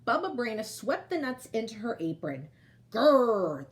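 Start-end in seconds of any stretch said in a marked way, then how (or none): 2.9-3.7 a loud growling bear roar, held back by a tired voice. Acted. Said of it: "the old bear roared".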